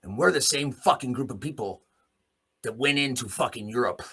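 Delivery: ordinary talk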